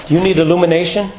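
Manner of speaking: ordinary delivery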